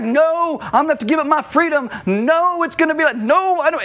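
high, fast, whiny protest; mock childish whine. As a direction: play us as stubborn kids refusing God. Believers acting like stubborn kids.

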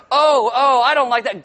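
mock protest. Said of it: "guys will say".

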